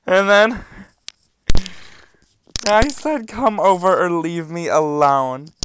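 high, loud, hammed-up ultimatum; exasperated shouting. Reading out an ultimatum to a persistent texter, exasperated shouting. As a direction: keep yelling, high and fed up. Still yelling exasperated texts.

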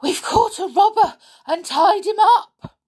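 high, loud, out-of-breath announcement; panting. A narrator's reading. Boy reporting a captured robber to his stepfather, panting. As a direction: blurt it out excitedly between pants. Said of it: "panted Sooty".

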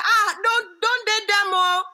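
put-on high, alarmed Nigerian-accented warning; imitating Nigerian mom. Nigerian mom forbidding daughter's dating, alarmed accented warning. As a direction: warn high-pitched in a Nigerian mom's accent. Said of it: "you said".

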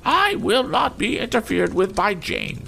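very high, slow imperious lady; majestic, haughty. A narrator's reading. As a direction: pronounce it with regal disdain. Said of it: "with a majestic glance".

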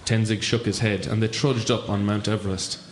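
usual speaking voice

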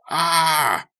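loud, high cry of exasperation; exasperated cry. A narrator's reading. Becca fleeing her ruined locker, exasperated cry. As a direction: let out an exasperated "Ah!". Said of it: "uttered an exasperated cry".

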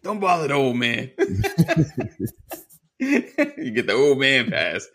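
high, laughing through the words; chuckling while teasing. Real, not acted. Laughing at gray beard joke.

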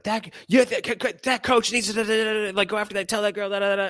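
high-pitched, sputtering rant; mock arguing. Parent argues with husband about the coach, mock arguing. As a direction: imitate an irate parent tripping over words. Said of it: "we got into an argument".

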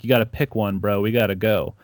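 ordinary talk

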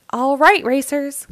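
high loud announcer opener; announcer call. A narrator's reading. The announcer calls the racers to the line, booming. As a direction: grab the racers' attention. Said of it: "called the announcer".

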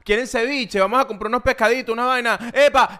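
high, excited beach party voice; party on a boat. Pretend.

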